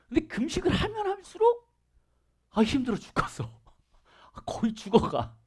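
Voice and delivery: a fasting believer groaning for pity, mock whine; mock whining. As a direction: pretend to suffer from fasting, half laughing. Mocking a suffering faster.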